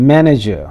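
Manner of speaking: "manager" with MAN stressed, the rest falling away; first-syllable stress. Shown as a demonstration. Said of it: "put stress on the first syllable". ("manager" के पहले syllable पर ज़ोर)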